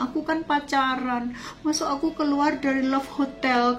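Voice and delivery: high-pitched mock-tearful lament; tangisan tiruan. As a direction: whine it like a wronged girlfriend, half-acted. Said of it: "Dia nangis-nangis". (keluhan tinggi meniru orang menangis)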